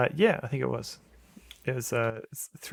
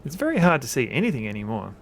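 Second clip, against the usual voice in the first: normal talking voice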